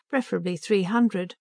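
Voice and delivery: usual speaking voice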